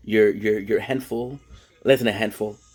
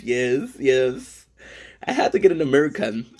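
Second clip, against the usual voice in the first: usual speaking voice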